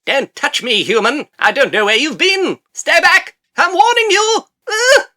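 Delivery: very high, loud put-on bug voice; acted alarmed insect. Odd insect pulled out with tongs, high escalating warning. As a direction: build from high protest to piercing alarm announcement.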